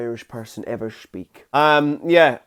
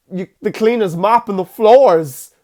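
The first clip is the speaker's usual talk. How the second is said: alarmed psychic, loud and higher; Christopher Walken; 7 semitones higher, 7 dB louder than usual. Retold or imitated. Retelling Walken's psychic sketch to viewers, mock alarm. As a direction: blurt out an urgent vision. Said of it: "He's like".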